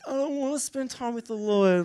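high-pitched mock tantrum whine; childish whining. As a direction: sulk back like a petulant child. Sulky reply to wife's advice.

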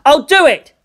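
put-on boy's resolute shout. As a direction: shout it out to everyone. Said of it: "Shinji shouted out".

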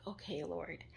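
whispered, yielding to God; a whispered yes to the Lord; whispering her acceptance to the Lord; whispered surrender. Retold or imitated. Surrendering to God's word.

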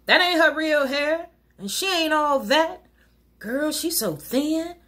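mock the other woman like a cattiest girlfriend; catty gossip. Mocking gossip about another woman.

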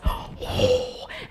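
high, breath-only gasp; sharp intake at the sudden blast of cold. Acted. Storyteller feels the air conditioner start, gasp.

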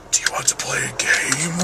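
quietly menacing film-villain impression; Jigsaw. Vlogger imitating a horror villain for viewers, creepy impression. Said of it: "sounds like Jigsaw".

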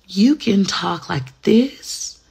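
slow, wide-eyed disbelief; amazed. Retold or imitated. Younger self amazed by sisters' talk, slow disbelief. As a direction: marvel at it slowly.

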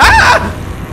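sudden terrified scream at a jump scare; real startled shriek, very high and loud. Real, not acted. Monster appears suddenly. (grito de susto real)

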